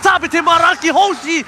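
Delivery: loud excited call; esports caster. Real, not acted. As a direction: keep the hype on the objective. Play-by-play winding down.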